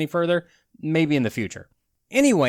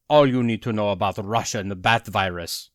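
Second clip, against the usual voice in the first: lower, hammed-up Russian accent on the headline; mock Russian accent; 7 semitones lower than usual. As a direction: read it like a cartoon Russian, lower in pitch. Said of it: "You do a Russian accent".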